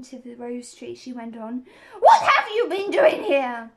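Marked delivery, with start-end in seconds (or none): 2.0-3.7 high, very loud demanding shout; angry shouted question. A narrator's reading. Queen turns from the rose tree, shouting her demand. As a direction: bellow the question, pitched high. Screaming Queen goes on interrogating.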